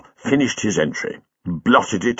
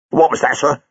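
sharp, higher challenge; sharply; 6 semitones higher than usual. A narrator's reading. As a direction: snap the question back. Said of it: "asked Kyle sharply".

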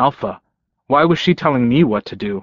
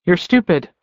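higher-pitched, serious verdict; 7 semitones higher than usual. A narrator's reading. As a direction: deliver it in earnest, pitched up. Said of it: "she said seriously".

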